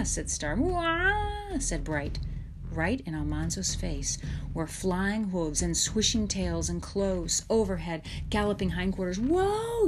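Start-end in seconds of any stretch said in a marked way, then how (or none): 0.6-1.6 high, loud animal cry; bawling calf. A narrator's reading. Star bolts with the sled, bawling. 9.2-9.9 very high, loud halt cry; yelled. A narrator's reading. Almanzo yells at the bolting calves, frantic. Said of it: "yelled Almanzo".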